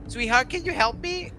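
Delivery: high coaxing call; sweet plea. Acted. Kobold king asking his wyvern, high sweet plea. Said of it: "He goes".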